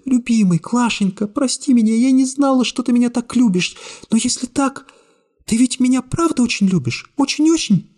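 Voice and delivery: high, sobbing-tinged murmur of love; high tearful young woman. A narrator's reading. Secretary tearfully clinging to the hero, high and weepy. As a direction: voice her high, choking back tears. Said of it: "бормотала она, глотая слёзы".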